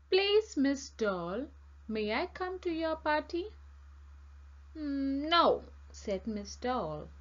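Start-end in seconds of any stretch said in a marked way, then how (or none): 0.1-3.5 pleading request. A narrator's reading. Fire Engine begs for an invitation. 4.7-5.6 firm refusal. A narrator's reading. Miss Doll refuses Fire Engine.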